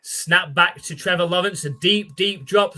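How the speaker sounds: lively sportscaster calling the snap; play-by-play call. Acted. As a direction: call the play like a TV announcer, building as the ball flies. Live play-by-play calling the game.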